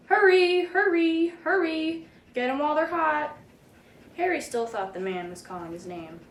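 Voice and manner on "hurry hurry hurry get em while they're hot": high, loud hawker's cry; vendor shouting. A narrator's reading. Hot dog man shouting to sell hot dogs, loud. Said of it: "He was shouting".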